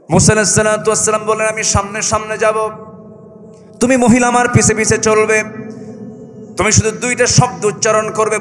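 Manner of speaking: high, loud sermon chant; full-force preaching. Acted. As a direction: preach it to the crowd.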